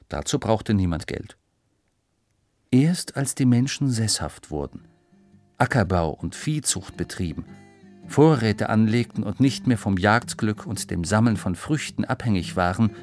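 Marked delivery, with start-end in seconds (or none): none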